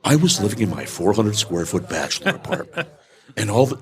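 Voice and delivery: low, put-on motivational speaker voice laughing through; Anthony Robbins. Guest offers host an impersonation, low laughing guru voice. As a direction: ham up a famous self-help guru. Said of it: "I can do an impersonation of him".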